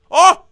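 sudden loud, high "oh"; startled yelp. Real, not acted. Player hears something, startled yelp. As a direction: jump with a short yelp.

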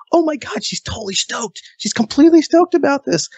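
a half-hearted giddy inner gasp; amazed fanboy thought. Retold or imitated. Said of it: "And I'm like".

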